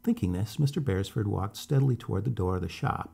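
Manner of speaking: usual speaking voice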